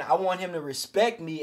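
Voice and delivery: ordinary talk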